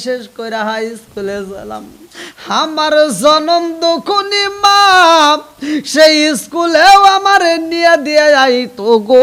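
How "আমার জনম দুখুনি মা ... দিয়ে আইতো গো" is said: high, loud chanted lament; impassioned preaching. A narrator's reading. Preacher recalls mother walking him to school, impassioned preaching.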